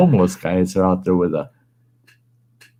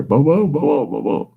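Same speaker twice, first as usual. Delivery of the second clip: boom noises; 8 semitones higher than usual. Retold or imitated. Imitating PlayStation game noise.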